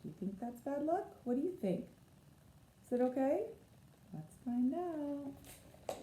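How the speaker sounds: put-on gentle storytime aside to the children; reading to children. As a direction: coax the kids to guess. Asks the listening children.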